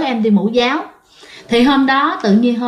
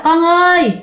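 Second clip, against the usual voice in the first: calling out; 6 semitones higher than usual. Retold or imitated. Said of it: "Thì mẹ mới kêu rằng á".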